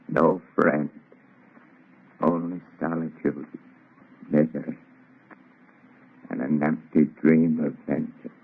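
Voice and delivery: ordinary delivery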